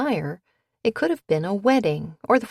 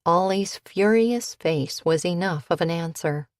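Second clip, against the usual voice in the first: ordinary delivery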